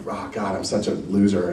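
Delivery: low, soft self-reproach; mild retold dejection; upset. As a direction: groan quietly at yourself, lightly acted. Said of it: "I was so upset".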